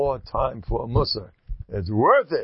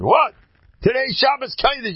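high, loud exclamation; rousing high-pitched cry; indignant outburst; 13 semitones higher, 6 dB louder than usual. Retold or imitated. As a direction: burst out in loud disbelief. Acting out rabbi's defiant outburst.

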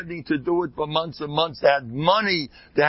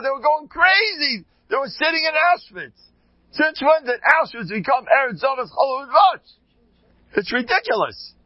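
high and louder, riled up; indignant rant; 12 semitones higher, 3 dB louder than usual. Real, not acted. Rabbi to his audience, worked up and indignant. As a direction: climb into outrage at the absurdity.

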